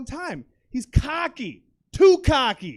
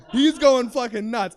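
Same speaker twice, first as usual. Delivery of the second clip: ordinary delivery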